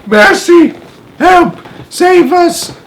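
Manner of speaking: high frantic cries for help; crying ladybug. A narrator's reading. Ladybug crying out in panic at the descending cloudman. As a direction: shriek for rescue as a tiny bug. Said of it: "cried the ladybug".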